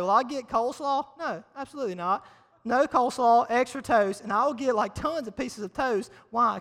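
normal talking voice

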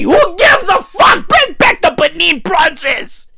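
high-pitched, shouted dismissal; exasperated outburst. Real, not acted. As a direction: erupt in exasperation, voice jumping up. Outburst dismissing the diary talk.

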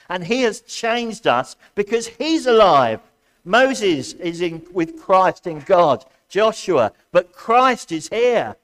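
loud, ringing preacher's proclamation; rousing sermon voice; preaching at full force. Acted. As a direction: preach it out to the congregation.

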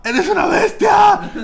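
high, slow mock yell; imitating a girlfriend screaming you brute; yelled accusation. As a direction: shout it at him like she did. Girlfriend yelling at him, retold.

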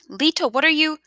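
high, startled cry; alarmed question. A narrator's reading. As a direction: blurt it out in alarm, pitch leaping up. Granddaughter alarmed as grandfather acts.

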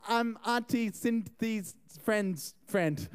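slow, halting made-up answer; mock hesitant bluff. Party crasher explaining himself to relatives, slow mock-hesitant bluff. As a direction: feel your way through the lie, word by word.

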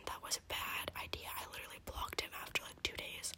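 secret story told in a whisper; whispered gossip. Real, not acted. Girl telling listeners a scandalous secret, whispering throughout. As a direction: whisper the whole juicy story.

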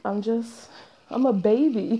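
normal talking voice

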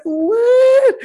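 high, loud astonished exclamation; amazed what. Retold or imitated. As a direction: blurt it out in disbelief. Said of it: "And I'm like".

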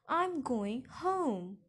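loud, very drawn-out shout. A narrator's reading. Daughter through her locked bedroom door, shouting. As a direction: shout it slowly, stretching the words. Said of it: "I shouted".

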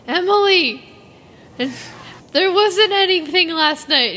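high, dramatic retold complaint; distressed wail. Fan retelling how they greeted Emily at school, dramatic distressed wail. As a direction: wail it to a friend, hammed-up.

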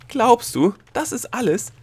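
high, loud exasperated question; incredulous outburst. A narrator's reading. Gnome challenges Delia's meagre result, incredulous and loud.

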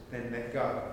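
low, soft, lightly voiced God; a hint of a calm divine voice; God's voice. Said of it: "And then the voice says".

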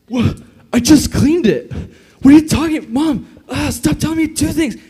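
put-on teen snaps back at mom who says clean your room, whiny and loud; whiny teen talking back. Bratty, exasperated teenage whine.

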